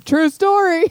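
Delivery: high mock bro voice; deadpan it like a teenager; son voice. Mimicking son.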